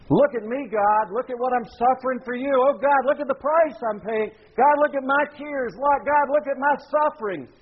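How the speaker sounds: high-pitched, hammed-up begging; desperate pleading. Prophets of Baal beg God to look at their suffering, mock pleading. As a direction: plead urgently, acting out a show of suffering.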